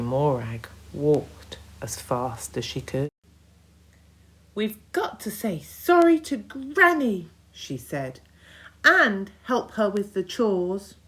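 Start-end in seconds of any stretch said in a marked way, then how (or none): 4.5-10.9 put-on higher, louder girl voice; girl urging cousins to apologise; earnest girl. A narrator's reading. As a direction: urge them sincerely. Katie urges apology.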